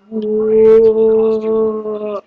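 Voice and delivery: long, low drawn-out groan; slow-motion whoa. Acted. Fighter caught in slow motion, long low whoa. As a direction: draw it out low as if slowed down.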